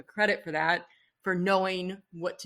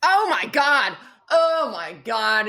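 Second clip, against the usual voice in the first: thrilled; 3 semitones higher, 11 dB louder, slower than usual. Real, not acted. Host remembering the Naomi Campbell sketch, loud and thrilled. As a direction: gush with delight, stretching the second one out.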